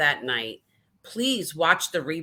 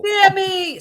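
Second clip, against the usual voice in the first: high, loud cry of delight; excited shout-out; 12 semitones higher, 7 dB louder than usual. Real, not acted. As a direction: call the name out in delighted greeting. Calling out to arriving friend.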